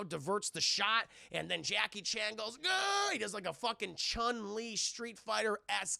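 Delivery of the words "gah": high drawn-out "gah" yelp; mock fight cry. Jackie Chan kicking Ricky Tan, mock fight cry. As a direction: imitate a martial arts kick cry. Imitating kung fu kick cry.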